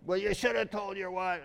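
neighbor's line retold without the yell; a lighter echo of the angry neighbor; neighbor's retort. Neighbor's retort recalled, a mild mock scold. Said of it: "He's yelling at me".

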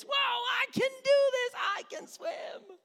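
very high shouted squeal of triumph; hammed-up, over-the-top childish elation; mock triumphant child. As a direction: shriek like a kid swimming for the first time. Child thrilled to be swimming.

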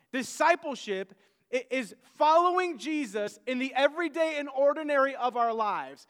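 loud, emphatic pulpit delivery; forceful preaching. Acted. Preacher pressing his flock on discipleship, forceful preaching. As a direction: preach it hard to the congregation.

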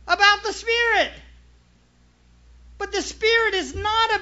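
full-force preaching. Acted. Preacher driving the point home to the church, full force. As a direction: preach it out to the congregation.

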